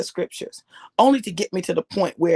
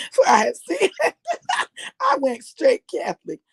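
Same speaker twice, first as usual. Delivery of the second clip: higher, laughing through the words; real giggles breaking up the line; 10 semitones higher than usual. Real, not acted. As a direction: crack up while getting the words out. Cracks up at her own confession.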